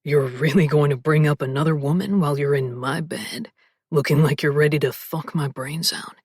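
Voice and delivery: low, husky male voice, whispered; male love interest voice. Acted. Male lead teasing.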